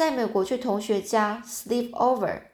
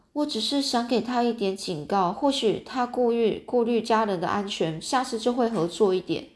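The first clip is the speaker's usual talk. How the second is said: ordinary delivery, nothing marked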